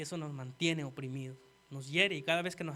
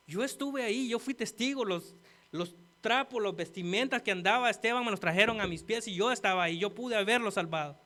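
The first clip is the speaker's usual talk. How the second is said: higher-pitched, impassioned confession; anguished self-reproach; 5 semitones higher, 5 dB louder than usual. Acted. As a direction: build louder as if reproaching yourself. Preacher voices Paul's imagined remorse.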